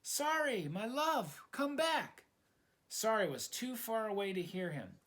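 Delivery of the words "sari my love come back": high, raised cry after her; shouting out. Acted. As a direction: call her back as she drifts off. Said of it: "Milo shouted out".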